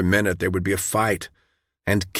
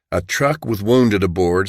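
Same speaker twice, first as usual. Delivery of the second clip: ordinary delivery, nothing marked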